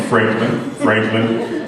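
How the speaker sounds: low ribbit-like croak; Franklin. Acted. Caged frog introducing himself to rescuers, low croak. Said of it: "a frog named Franklin".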